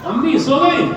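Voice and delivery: normal talking voice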